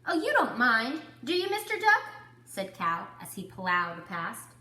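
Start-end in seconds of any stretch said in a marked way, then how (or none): 0.1-2.0 high, loud, carefree chatter; breezy cow. Acted. Cow plows past the duck, high and breezy. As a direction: brush right past him.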